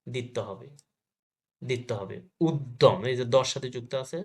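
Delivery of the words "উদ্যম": উদ্যম said loud as ud-dom; doubled দ. Shown as a demonstration. Teacher shows students the doubling, উদ্যম as ud-dom. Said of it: "দ double হয়েছে".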